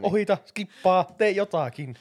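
high, loud urging; urgent plea. Real, not acted. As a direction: press the team to act now. Urging teammates as time runs out.

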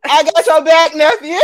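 loud, high hype to the nephew; loud cheer. Real, not acted.

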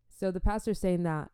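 normal talking voice

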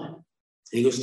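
ordinary delivery

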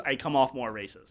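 ordinary talk

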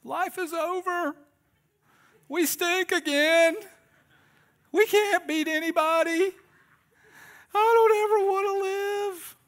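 hammed-up heartbroken football fan, shrill and loud; despairing fan. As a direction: wail it like a melodramatic fan whose season is lost. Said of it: "they're gonna post on social media".

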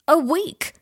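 sharp high startled question. A narrator's reading. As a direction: jolt up in shock at the news.

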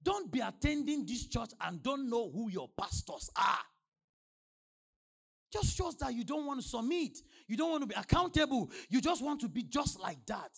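loud, high-pitched sermon delivery; full-force preaching. Real, not acted.

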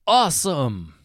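loud delighted awesome; thrilled kid. A narrator's reading. Boy stepping out of the fireplace, loudly thrilled. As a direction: burst out with it.

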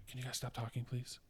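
hushed, polite ask to stop talking; quiet polite request. Retold or imitated. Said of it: "as quiet as you can".

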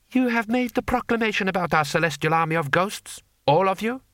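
high, fast, lofty voice; haughty lord. Acted. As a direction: rattle off superior certainty. Lord Hong interrogating.

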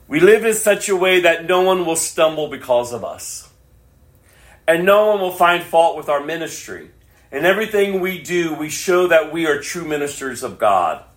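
high, loud full-force preaching; loud, high, fervent scripture reading. Real, not acted. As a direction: proclaim the verse to the rafters.

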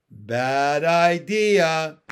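very slow, booming warning; cocky, drawn-out frog retort; loud frog taunt. A narrator's reading. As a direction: stretch it out, loud and deliberate. Frog taunts the threatening turtle.

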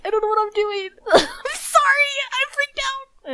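put-on high, crying kid apologizing, slowing at the end; mock sobbing. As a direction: blubber it out like a caught child. Retelling tearful confession to mom.

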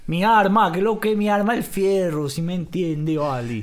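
loud, high maracucho slang; Maracaibo accent. Retold or imitated. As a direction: belt it like a swaggering maracucho. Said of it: "el maracucho dijo".

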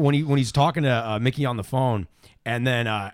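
normal talking voice

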